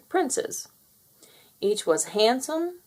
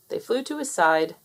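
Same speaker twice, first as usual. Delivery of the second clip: usual speaking voice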